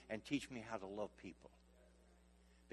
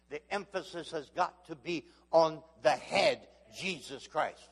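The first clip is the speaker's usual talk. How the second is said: rising higher and louder with each not; building preaching; 5 semitones higher, 7 dB louder than usual. Acted. Preacher driving his point home to the church, building. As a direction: build to a full preached climax.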